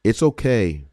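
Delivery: ordinary talk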